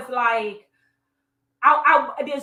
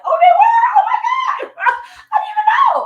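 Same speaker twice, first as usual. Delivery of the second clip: high, loud squeal of shock breaking into laughter; shocked disbelief; 9 dB louder than usual. Retold or imitated. Naive cheerleader finding out she was race checked, shocked. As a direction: shriek it, then laugh at yourself.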